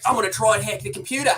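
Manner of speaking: bad mock Kiwi Australian accent, fast; a hint of a Kiwi Australian accent. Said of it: "sorry for my Kiwi Australian accent".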